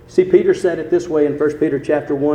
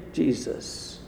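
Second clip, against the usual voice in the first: hushed; 4 semitones lower, 9 dB quieter than usual. Acted. Drops to hushed final word.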